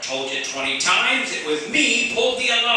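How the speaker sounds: loud, rising protest of innocence; angry protest. Acted. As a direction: insist hard, voice climbing high. Suspect protesting accusation loudly.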